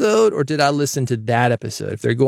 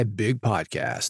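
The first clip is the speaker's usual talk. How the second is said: imitating the intro voice guy; 2 semitones lower, 8 dB quieter, much slower than usual. Host imitating his podcast's intro announcer, slow and soft. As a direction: drop into a lightly voiced radio imaging guy. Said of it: "you always hear the guy".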